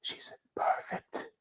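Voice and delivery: slow, awed whisper; drawn-out hushed whisper; whispered movie quote. Acted. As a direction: whisper it slowly like a film line. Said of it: "as the Fifth Element says".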